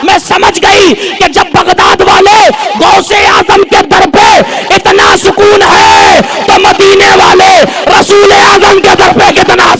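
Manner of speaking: high, drawn-out shouted climax; full-force preaching. Acted. Preacher voicing the woman's reason for converting, full force. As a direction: preach her answer slowly at ringing full pitch.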